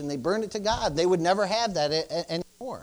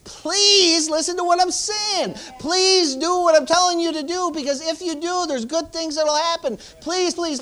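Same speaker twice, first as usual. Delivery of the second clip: emphatic urging; 7 semitones higher, 3 dB louder than usual. Shown as a demonstration. Preacher begs the congregation to listen, emphatically urging. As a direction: plead loudly, then keep the pitch up. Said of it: "what does emphatically urging look like".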